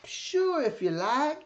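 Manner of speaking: put-on very high, easy-going old-man voice; a sudden high, agreeable little yes; imitating Jack Davis. Narrator imitates Jack Davis agreeing to write the foreword, high kindly voice. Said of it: "and it just talked like this".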